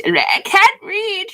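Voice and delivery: high, drawn-out mock wail; loud high-pitched self-parody; mock helpless wail. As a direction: play up the helplessness in a stretched, high voice. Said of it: "I'm just like this".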